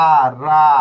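আ, রা called out loudly; loud model syllable. Shown as a demonstration. Teacher sounding out a syllable for students, loud. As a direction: belt out আ, রা as the model. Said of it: "এরপরে এর".

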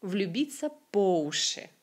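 по уши as one word, stressed on по. Shown as a demonstration. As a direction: hit по hard in по уши. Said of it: "on fait l'accent sur".

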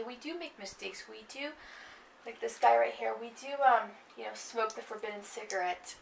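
ordinary talk, nothing marked

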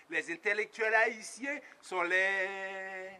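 ordinary delivery

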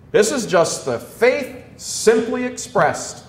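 loud, ringing sermon voice; preaching at full force. Acted. Priest urging his flock, preaching at full force. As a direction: hammer the truth home from the pulpit.

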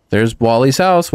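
ordinary delivery

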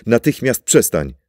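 husband shouting at his wife to stop; angry shout. Acted. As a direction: snap at her to stop now. Husband yanks wife's wrist.

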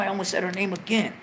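usual speaking voice

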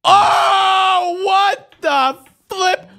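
very high, drawn-out shout; real high yell of game frustration; dismayed yell. Real, not acted. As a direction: wail it loudly in disbelief. Game goes wrong, cries out.

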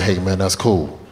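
ordinary talk

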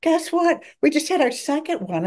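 excited patient. Retold or imitated. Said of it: "you get an email like".

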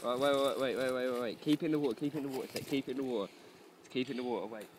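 normal talking voice

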